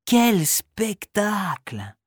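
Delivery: slow, soft, amazed gasp; awed exclamation. Acted. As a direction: marvel at the show. Children amazed by the dance.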